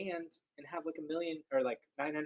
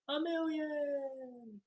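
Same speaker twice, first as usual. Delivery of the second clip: slow, much higher, drawn-out big reveal; dramatic reveal; 12 semitones higher, much slower than usual. Acted. Teacher revealing the answer to the class, slow and high. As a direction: unveil it like a magic trick.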